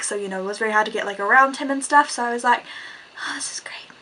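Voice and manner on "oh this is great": hushed, breath-only aside; dismayed whisper. Retold or imitated. Trapped on the bus beside a stranger, whispered in dismay.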